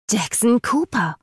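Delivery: höher und langsam, gereizt; ungeduldig fauchend. Acted. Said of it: "fauchte Eden ungeduldig". (ungeduldig, gereizt fauchend)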